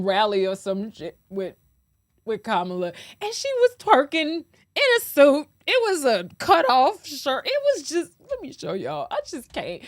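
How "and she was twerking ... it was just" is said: outraged disbelief. Real, not acted. Outraged rant about twerking.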